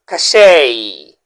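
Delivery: "Cachet" said ka-SHAY, the t silent, ending on "ay"; correct pronunciation. Shown as a demonstration. Teacher modeling "Cachet" for viewers, French pronunciation. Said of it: "Can you say cachet?".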